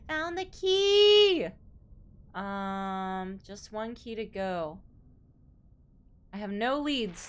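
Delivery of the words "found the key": loud triumphant cheer; excited. Real, not acted. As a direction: shout it in triumph. Finding the key.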